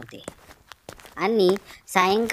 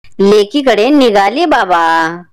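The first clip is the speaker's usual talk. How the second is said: put-on louder, slow old woman's voice; old grandmother; 8 dB louder, slower than usual. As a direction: speak as the village grandmother, slow and loud. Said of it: "म्हातारी म्हणाली".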